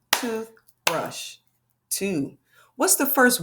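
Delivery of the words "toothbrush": Toothbrush slowly split into tooth, pause, brush; clapped-out syllables. Shown as a demonstration. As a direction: say Toothbrush one beat per syllable. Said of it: "Let's try it again".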